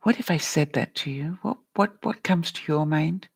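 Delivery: normal talking voice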